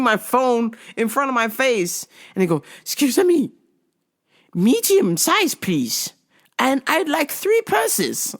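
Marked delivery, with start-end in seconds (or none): 2.8-8.4 high, slow imitation of a Chinese-accented shopper; mock Chinese accent. Shopper mistaking customer for store staff, imitated high Chinese accent. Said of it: "all the Chinese ladies think I work there".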